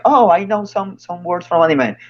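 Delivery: put-on know-it-all enthusiasm; mock eagerness. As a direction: pipe up like a proud beginner. Voicing an eager anime fan.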